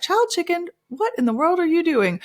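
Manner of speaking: high teasing call; playful scold. Retold or imitated. Mom teasing her kids, high playful voice. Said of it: "And so I'll be like".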